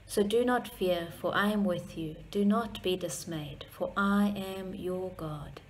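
ordinary talk, nothing marked

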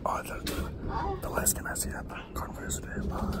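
whispering. Real, not acted. Whispered aside to camera.

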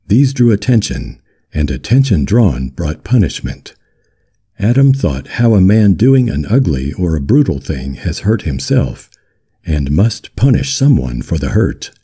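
plain reading voice

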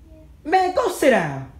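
sharp, high shout; yelling at sister. Real, not acted. Sister yelling at little sister to go sit down, high and loud. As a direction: order her to sit down.

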